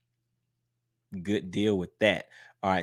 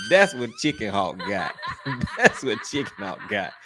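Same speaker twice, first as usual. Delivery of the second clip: cracking up at your own joke; laughing tease. Real, not acted. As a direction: say it through real laughter. Laughing at own joke about Chicken Hawk.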